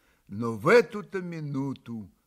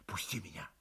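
low, much softer plea; whispered; 7 semitones lower, 14 dB quieter, faster than usual. A narrator's reading. Katya begs Mitya to let her go, whispering. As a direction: barely breathe it out. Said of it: "прошептала она".

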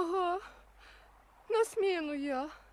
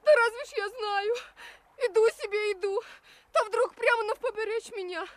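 high, upset, crying voice; tearful; 4 semitones higher than usual. Acted. Rescued woman explaining to her helper, tearful. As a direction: pour it out through tears. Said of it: "Ладно, не плачьте".

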